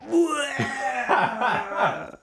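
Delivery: retold dopey giggle; high drunk giggle. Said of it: "And then he just goes".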